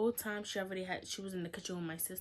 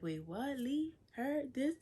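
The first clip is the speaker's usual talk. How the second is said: mock incredulity, each short question pitched up; confused disbelief; 8 semitones higher, 4 dB louder, slower than usual. Sister hearing of the pregnancy, confused disbelief. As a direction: imitate baffled questions, climbing high and louder. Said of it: "she was so confused".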